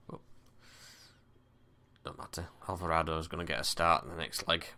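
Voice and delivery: ordinary talk, nothing marked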